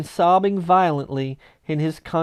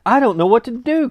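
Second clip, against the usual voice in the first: laughing and crying; 7 semitones higher, 5 dB louder, faster than usual. Acted. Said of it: "laughing and crying in the same breath".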